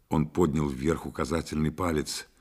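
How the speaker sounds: ordinary delivery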